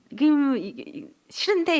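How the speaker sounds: rising, petulant "싫은데"; sulky retort. Retold or imitated. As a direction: toss it back like a sulky kid. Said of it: "이런다는 거예요". (토라진 듯 되받아치는 말투)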